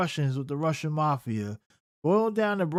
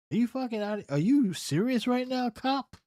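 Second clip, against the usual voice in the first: rising high, stunned; mock the cop in disbelief; are you serious; incredulous; 7 semitones higher than usual. Real, not acted. Disbelief at cop's claims.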